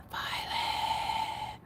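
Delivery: soft unvoiced whisper; real hushed whisper to the dog. Real, not acted. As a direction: whisper so no one wakes.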